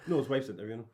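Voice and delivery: ordinary delivery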